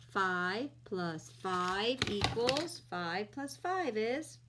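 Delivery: put-on friendly teacher talking to kids; kids show host. Teaching kids addition on camera.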